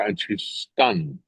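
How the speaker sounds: normal talking voice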